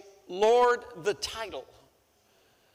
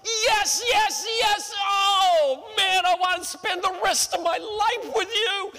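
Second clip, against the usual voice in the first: high, loud ecstatic shriek; high-pitched squeal of joy at the proposal; screaming yes; 12 semitones higher, 4 dB louder than usual. Acted. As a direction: scream yes like she won the lottery. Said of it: "she screams out in the restaurant".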